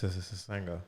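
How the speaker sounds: slow, hissing the S-S-S-S-Sanga like a snake; drawn-out s like a snake. Pretend. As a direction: stretch each s in S-S-S-S-Sanga as a hiss. Repeats joke name, slowed down.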